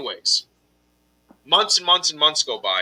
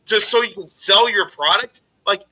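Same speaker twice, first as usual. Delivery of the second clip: much higher, louder, incredulous outrage; outraged disbelief; 4 semitones higher, 3 dB louder than usual. Retold or imitated. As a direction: fire back at the nerve of it. Scammer asks for livestream spot.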